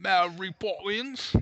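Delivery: high, slow, exaggerated fake Cockney. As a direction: stretch it out in a bad British accent.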